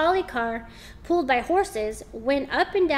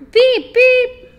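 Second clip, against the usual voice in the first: high loud honking beep; horn beep; 11 semitones higher, 12 dB louder than usual. A narrator's reading. Narrator honking a car horn loudly. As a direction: honk like an old motor car horn.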